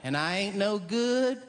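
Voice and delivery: high, slow, pitiful Southern twang; sad Southern lady. Retold or imitated. Comic voicing a Southern lady putting herself down. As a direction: whimper it like a self-pitying country woman. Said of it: "some lady might go".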